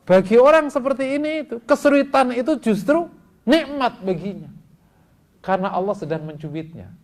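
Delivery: loud, high, emphatic sermon; forceful preaching. Real, not acted.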